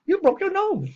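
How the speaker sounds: high, strained put-on voice of the doctor; mock doctor voice. The doctor greeting the patient in a high mock voice. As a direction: squeeze the voice up high to play him. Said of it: "he goes".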